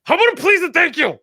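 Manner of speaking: high-pitched, mock-exasperated reproach; exasperated scolding. Acting out scolding a rude customer in line, high and exasperated. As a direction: burst out with the pent-up reproach.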